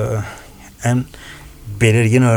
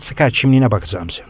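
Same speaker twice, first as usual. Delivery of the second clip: whisper. Retold or imitated. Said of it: "Karadenizli abimiz".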